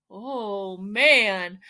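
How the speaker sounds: usual speaking voice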